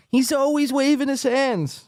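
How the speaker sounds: high-pitched mimicking of his line; mocking Chad. Host mocking Chad's line, high mock voice. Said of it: "That's Chad's big take".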